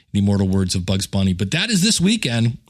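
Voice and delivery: ordinary talk, nothing marked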